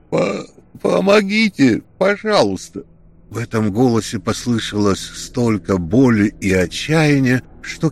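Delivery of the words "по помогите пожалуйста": high, loud stammered plea; desperate cry for help. A narrator's reading. Sinking Grek begs for help, high loud desperate cry. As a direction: cry out in pain and despair. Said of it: "крикнул".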